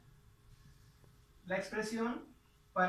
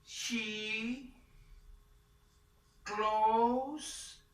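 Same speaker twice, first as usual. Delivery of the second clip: slow teacher read; 2 semitones higher, much slower than usual. Shown as a demonstration. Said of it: "Entonces sería".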